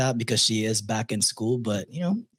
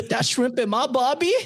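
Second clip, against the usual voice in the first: put-on jokey Australian accent, up high; mock Australian; 9 semitones higher than usual. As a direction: ham up an ocker bloke, pitch soaring. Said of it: "if you are an Australian listener".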